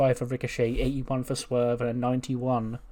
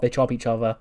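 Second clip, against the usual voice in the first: usual speaking voice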